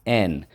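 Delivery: "en" said alone; first syllable en. Shown as a demonstration. Teacher sounding out the first syllable of enable. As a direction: clip it to "en". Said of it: "First syllable".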